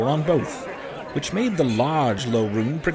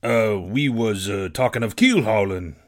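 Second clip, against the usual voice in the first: put-on sheepish old sailor; 5 semitones lower than usual. Morgan owns up to Silver, halting and low. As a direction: hesitate meekly, hunting for the words.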